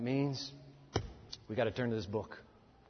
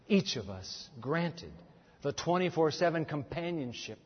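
prayer; 4 semitones lower, 4 dB quieter than usual. Acted. Pastor praying for standing congregation, hushed reverent prayer. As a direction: pray softly for the standing church.

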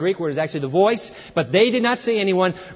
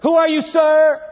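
high, loud questioning call; raised, urgent question; startled cry; 10 semitones higher, 6 dB louder than usual. Acted. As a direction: shout it up at an unseen voice. Saul's cry, repeated with sir.

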